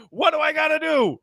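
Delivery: high exasperated cry for help; mock frustrated plea. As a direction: act out a fed-up player begging for answers. Retold frustrated player pleading.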